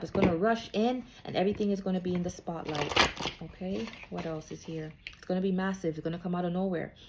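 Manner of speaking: normal talking voice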